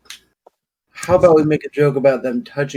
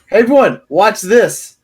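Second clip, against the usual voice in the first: booming, higher and louder call to the crowd; magically amplified voice; 6 semitones higher, 5 dB louder than usual. Acted. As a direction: boom it out like a spell-boosted herald. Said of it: "boom my voice really loud".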